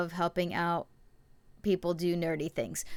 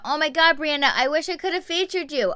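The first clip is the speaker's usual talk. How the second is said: high-pitched excited gush; 8 semitones higher, 4 dB louder than usual. Real, not acted. Host reacting to Brianna's art school news, gushing excitedly. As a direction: gush with delight, pitch jumping up.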